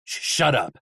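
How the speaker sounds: understated stammered snap; mild stuttering irritation; snapping. A narrator's reading. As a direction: cut them off, tripping on the first word. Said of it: "Hap snapped".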